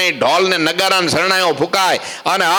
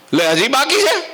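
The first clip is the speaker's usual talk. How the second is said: exasperated disbelief; 10 semitones higher than usual. Acted. Narada exasperated at another task.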